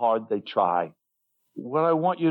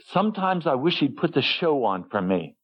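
ordinary talk

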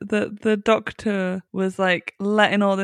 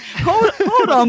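high, drawn-out mock plea to wait; comic "wait a moment" call; imitated Doctor stalling; 10 semitones higher, 4 dB louder, slower than usual. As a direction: stall someone in a raised voice. Voicing the Doctor stalling Bill.

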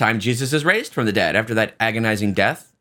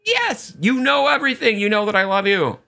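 high-pitched, exasperated protest; upset; 10 semitones higher than usual. Retold or imitated. Peter asked a third time by Jesus, acted upset. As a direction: imitate someone upset, voice pitched right up. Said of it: "And Peter gets upset".

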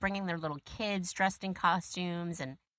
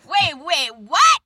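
high, loud disbelieving wait what; stunned; 9 semitones higher, 13 dB louder, slower than usual. Retold or imitated. Daughter stunned by dad's news, loud high disbelief. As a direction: stop him mid-story in shock. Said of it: "And I was like".

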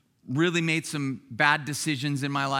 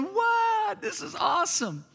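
high-pitched thrilled disbelief; excited amazement; 13 semitones higher than usual. Acted. Israelites amazed after the Red Sea crossing, excited exclaiming. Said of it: "They're excited, going".

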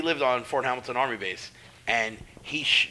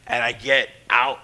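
ordinary talk, nothing marked

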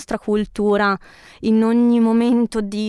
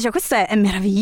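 normal talking voice

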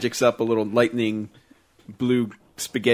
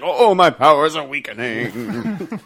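melodramatic villain; 3 semitones higher, 4 dB louder, slower than usual. Retold or imitated. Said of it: "Jafar's like".

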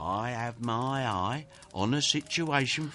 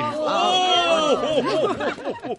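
delighted laugh; 12 semitones higher, 5 dB louder than usual. Acted. Father delighted by the pudding.

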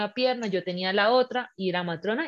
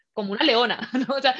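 usual speaking voice

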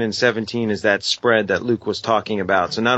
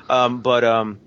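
ordinary talk

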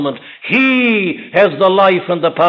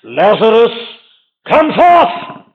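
loud, high call with a rising end; 4 semitones higher, 4 dB louder, slower than usual. Acted. Christ calling Lazarus from the tomb, a loud cry. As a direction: call him out of the grave. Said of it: "he says with a loud voice".

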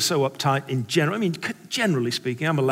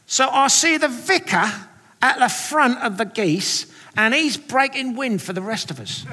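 cartoon Cockney accent; 5 semitones higher than usual. Sunday school teacher sharing her vision, cartoon Cockney accent. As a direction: draw out each phrase as a cartoon Cockney seer. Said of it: "talking with what sounds like almost a kind of cartoon accent".

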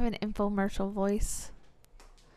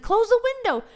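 pitched up and pressed; high emphatic repeat; 13 semitones higher, 7 dB louder, faster than usual. Retold or imitated. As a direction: say it up high and firm. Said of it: "I said".

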